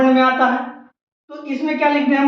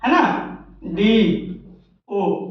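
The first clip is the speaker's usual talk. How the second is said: letter by letter; 5 semitones lower, 3 dB quieter, much slower than usual. Shown as a demonstration. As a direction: spell एस and डीओ out slowly. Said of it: "SDO कैसे लिखते हैं?". (एस... डीओ, धीरे-धीरे अक्षर अलग करके)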